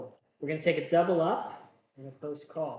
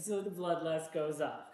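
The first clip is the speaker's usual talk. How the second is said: ordinary delivery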